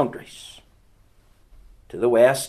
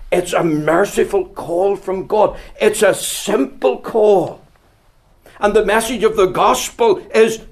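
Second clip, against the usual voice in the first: louder, higher, slowing on the key words; preaching at full force; 9 semitones higher, 6 dB louder than usual. Acted. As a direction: build it into a full-force pulpit appeal.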